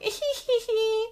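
high, slow put-on giggle; a hammed-up little tee-hee; mock giggle. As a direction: play the coy hiding girl, tittering. [name] hides, giggling.

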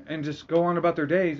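ordinary delivery

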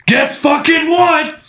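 slow, high, laughing drill-sergeant shout; big booming voice. Retold or imitated. Drill sergeant booming at recruits, slow laughing shout. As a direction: boom it out like a Full Metal Jacket drill sergeant. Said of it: "that big booming voice".

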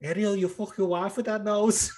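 high Scouse fighter impression; Darren. Fighter ribbing Ariel about his nose, high impression. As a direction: pitch up the cheeky taunt. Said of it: "he always says that".

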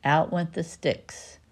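ordinary delivery

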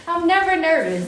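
laughing through nerves; nervous giggle, then a high line; nervous laughter. Real, not acted. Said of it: "I'm laughing because I'm nervous".

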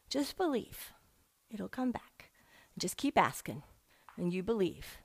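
soft mentor's hush of comfort; gentle encouragement. Retold or imitated. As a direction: coax her softly to have faith. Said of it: "She said".

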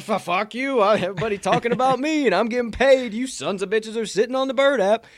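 loud, high mock tirade; acted cocky outburst; defiant retort. Acts coach's defiant retort.

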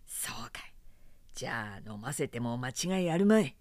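hushed unvoiced whisper. A narrator's reading. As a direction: whisper it so nobody overhears. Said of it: "何かささやくと".

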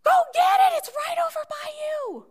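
high, loud call across the stands; dad calling out to William in the stands, loud and high; yelling. Retold or imitated. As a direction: shout to the boy so he hears. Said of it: "And he yells".

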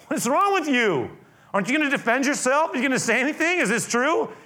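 high, loud baffled questions; can't believe he won't defend himself; astonished Pilate. Acted. Governor baffled by Jesus's silence, high and loud. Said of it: "Much to the governor's surprise".

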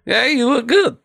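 high put-on compliment; mock admirer. Admirer praising someone's looks, high mock voice. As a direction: lift the voice way up, teasing.

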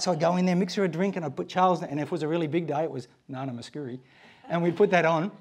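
usual speaking voice

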